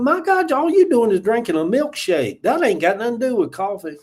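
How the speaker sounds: high scolding disbelief at a friend; half-mocking outrage at a buddy; mock exasperation. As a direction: tease him for his milkshake coffee. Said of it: "I'm like".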